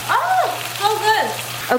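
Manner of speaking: slow, high drawn-out oh; delighted. Real, not acted. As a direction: savor the taste, stretched high and slow. Tasting the food on camera.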